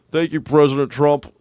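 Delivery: dopey mock-grateful voice; sarcastic thanks in a put-on voice; mocking imitation. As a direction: parrot it back as if stupid.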